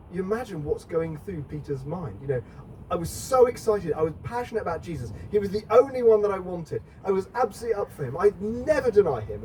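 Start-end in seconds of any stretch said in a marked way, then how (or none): 2.9-9.4 high, quick, keen recollection; excited Peter. Retold or imitated. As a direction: relive the zeal in a rush. Imagining Peter's thoughts.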